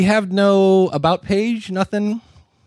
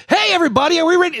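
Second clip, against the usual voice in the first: much higher, loud, bouncy greeting; peppy host; 12 semitones higher, 4 dB louder, much faster than usual. Retold or imitated. Knitting host greeting listeners after a dull intro, much higher and peppy. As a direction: burst in like an over-eager host. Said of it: "And she comes".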